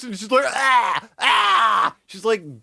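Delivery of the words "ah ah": high, loud gagging cry; hammed-up retching wail; imitated gagging shriek; mock gagging wail. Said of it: "gagging visibly".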